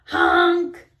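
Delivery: startled horn honk; loud high honk. A narrator's reading. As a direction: blast it like a truck horn. Sudden horn in story.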